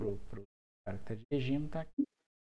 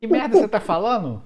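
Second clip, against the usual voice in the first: higher, louder angry yell; angry shout; 8 semitones higher, 9 dB louder than usual. Acted. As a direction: yell it at the fool. NPC boss scolds the prisoner.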